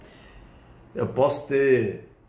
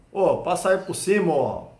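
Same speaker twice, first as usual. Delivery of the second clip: retold stranger's rude order, louder and slow; rude retort; 5 semitones higher, 4 dB louder, slower than usual. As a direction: mimic the rude guy in the queue. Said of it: "ele olhou pra trás assim e falou". (grosseria imitada, mais alta e lenta)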